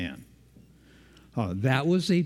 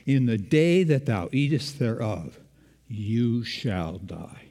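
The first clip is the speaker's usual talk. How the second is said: solemn quote; slower than usual. Retold or imitated. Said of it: "they said".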